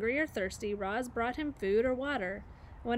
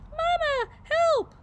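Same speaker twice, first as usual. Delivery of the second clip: put-on tiny high squeak of a scared baby goose; squeaking gosling; 8 dB louder, much slower than usual. A narrator's reading. Gosling squeaks to his mother for help, high panicked squeak. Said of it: "squeaked Bright Bill".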